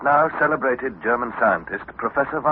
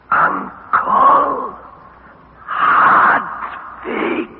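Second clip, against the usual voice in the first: clear call into the wall; 6 dB louder, much slower than usual. Acted. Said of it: "as clearly and as distinctly as I could".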